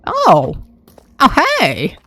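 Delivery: sudden high startled oh; surprised. Real, not acted. Player noticing something near her base, surprised. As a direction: caught off guard, voice jumps up.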